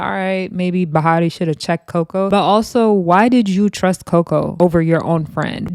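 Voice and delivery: ordinary delivery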